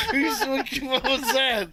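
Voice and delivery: high, giggly talking through laughter; breaking into laughter; laughing. Real, not acted. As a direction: crack up while speaking. Cracking up at own bit.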